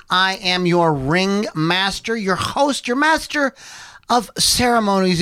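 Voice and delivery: ordinary delivery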